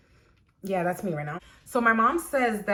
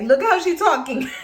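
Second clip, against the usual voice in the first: laughing; 7 semitones higher, 7 dB louder than usual. Real, not acted. Mom laughing at the lady.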